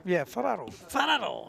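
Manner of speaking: Ferraro in a put-on Italian accent. Shown as a demonstration. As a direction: copy the Italian way of saying Ferraro. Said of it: "I gotta get my Italian accent working good".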